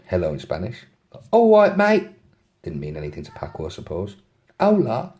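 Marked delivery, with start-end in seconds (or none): 1.3-2.1 high, loud London greeting; put-on London accent, pitched right up. London girl greeting the taxi driver, put-on London accent. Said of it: "have a go at the accents". 4.6-5.1 put-on higher Spanish hello, London accent; put-on London accent on a Spanish word; London-accented Spanglish. London girl trying Spanish on the driver, London accent. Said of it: "in the best Spanglish".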